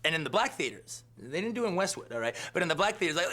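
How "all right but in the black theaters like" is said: ordinary talk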